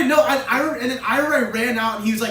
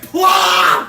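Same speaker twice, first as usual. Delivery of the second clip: shouted "Plop!", louder and higher; 8 semitones higher, 8 dB louder than usual. Retold or imitated. Friend insisting it was loud, shouted. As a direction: yell the plop out. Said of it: "No, it was loud".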